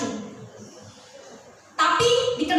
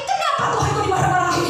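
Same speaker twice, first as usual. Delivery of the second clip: higher, laughing indignant question; roused and amused; 5 semitones higher than usual. Retold or imitated. Prisoner roused by guards mocking his God, laughing disbelief. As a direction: laugh it off as his spirit rises. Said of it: "semangatnya bangkit".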